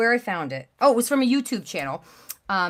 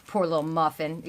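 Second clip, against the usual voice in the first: ordinary delivery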